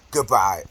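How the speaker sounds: "goodbye" said with pointed emphasis; emphatic goodbye. Retold or imitated. Imitating her ending a phone call, "goodbye" with emphasis. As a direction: lean on the word "goodbye", imitating her sign-off. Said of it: "she say it with the emphasis".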